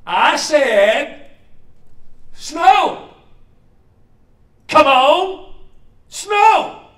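high, loud demanding shout; a kid bossing the sky at full voice; boy shrieking his demand at the sky; upset yelling. Retold or imitated. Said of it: "he gets a little upset".

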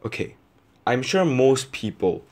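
ordinary talk

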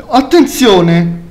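ordinary talk, nothing marked

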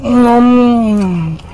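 high rising mmm of taste; delighted hum. Real, not acted. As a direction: savor the bite aloud. Tasting marrow, delighted.

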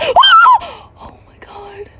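long high shriek of fright; scream, then gasp out in shock; loud, high, panicked outburst; startled scream. Real, not acted. Mouse appears again.

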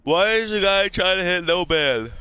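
loud exasperated. Real, not acted. Annoyed at teammate's ban.

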